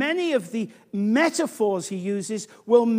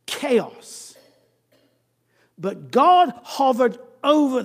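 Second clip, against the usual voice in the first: louder, slow emphatic preaching; preaching force; 6 dB louder, slower than usual. Real, not acted. Preacher to congregation, preaching with force. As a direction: hammer each phrase home.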